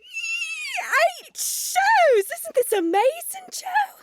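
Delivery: very high, giddy excitement; thrilled squeal. Acted. Singer tells Joe about eight shows, thrilled. Overjoyed at being kept on.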